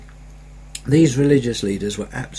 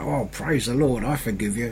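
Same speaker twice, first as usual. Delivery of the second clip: through the teeth; 2 semitones lower, 6 dB quieter than usual. Retold or imitated. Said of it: "saying through your teeth".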